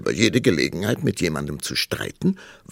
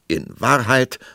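raised, louder, final; argument-ending tone; 7 semitones higher, 6 dB louder than usual. A narrator's reading. King interrupting queen to end the quarrel, decisively. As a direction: cut in to close the debate. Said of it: "im Tonfall eines Mannes, der einen Streit beendet".

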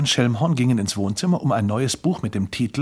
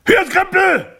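raised voice, very high and loud; bellowing; 6 dB louder than usual. A narrator's reading. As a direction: roar it out. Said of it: "erhob er seine Stimme und brüllte".

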